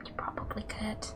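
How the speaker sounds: hushed teasing whisper. Real, not acted. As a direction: drop to a whisper. Whispered joke.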